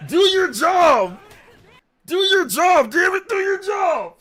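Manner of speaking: high, loud drawn-out bellow; mock coach yell. Streamer yells at YouTubers who slept in, high mock shouting. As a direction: holler it like an exasperated coach. Imitates coach yelling at players.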